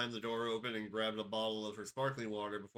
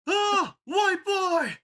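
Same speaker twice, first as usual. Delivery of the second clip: sudden high shriek; jump scare shriek; 16 dB louder, much slower than usual. Pretend.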